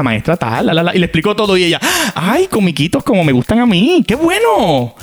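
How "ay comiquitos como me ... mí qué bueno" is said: high, mock-sweet sarcasm; sarcastic. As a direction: welcome the clown with fake delight. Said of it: "bien sarcástica". (sarcasmo, voz aguda y falsa)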